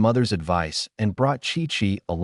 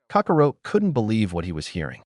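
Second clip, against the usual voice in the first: plain reading voice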